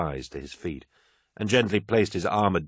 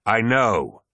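sudden loud, raised outburst; shouted; 5 semitones higher, 6 dB louder than usual. A narrator's reading. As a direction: cut the aide off with a shout. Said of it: "shouted Horus".

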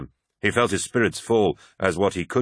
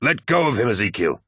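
raised, higher-pitched plea to stop; crying out; 4 semitones higher than usual. A narrator's reading. Loken to First Captain choking the apothecary, crying out. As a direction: urgently call off an attacker. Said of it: "cried Loken".

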